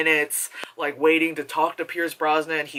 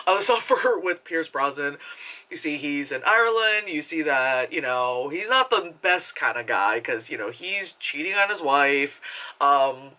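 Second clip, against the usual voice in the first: ordinary talk, nothing marked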